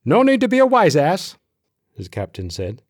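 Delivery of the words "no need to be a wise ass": high, loud telling-off; sharp rebuke. A narrator's reading. Captain scolds his cheeky corporal, loud rebuke. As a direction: pull rank and raise your voice.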